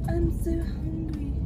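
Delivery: high, slow moan of hunger; mock-pleading hungry complaint; imitated hungry sister.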